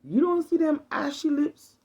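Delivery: high, loud scolding grandma voice; granny telling off her granddaughter, loud and high; African grandmother scolding. Retold or imitated. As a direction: nag like a grandmother fussing over ashy lips. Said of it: "I could hear my African grandmother".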